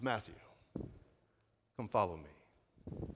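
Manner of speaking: low, soft, slow and tender; softly and lovingly inviting the outcast; loving invitation. Acted. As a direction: call him gently with compassion. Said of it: "very lovingly, very compassionately".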